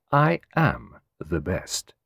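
slow, unhurried foreign detective voice; Poirot, self-assured. Acted. Poirot assuring his client of his skill, slowly. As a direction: savour each word with calm pride.

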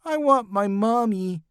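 put-on high, fearful little-girl whimper; timid high-voiced plea. A narrator's reading. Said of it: "whimpered Emily".

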